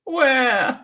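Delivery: hammed-up baby wail; high fake sob; fake crying wail. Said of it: "crying gets me out".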